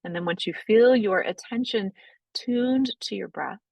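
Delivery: calm, unhurried breathwork guidance; guided meditation. Acted. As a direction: ease the class gently into noticing the breath. Leads a guided breathing practice.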